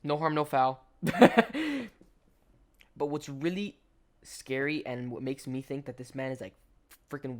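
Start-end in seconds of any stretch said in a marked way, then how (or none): 1.0-1.9 laugh. Real, not acted. Laughs after "no harm, no foul.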